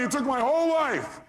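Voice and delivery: high, wailing mock complaint; distraught caller. Retold distraught caller on radio.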